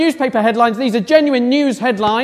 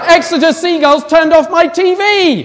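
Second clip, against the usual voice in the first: loud, higher news-anchor headline; headline announcement; 5 semitones higher, 6 dB louder than usual. Acted. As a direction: proclaim it like big breaking news. Reading headline after fanfare.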